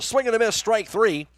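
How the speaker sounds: excited strikeout call. Real, not acted. Radio announcer calling a strikeout for listeners, excited.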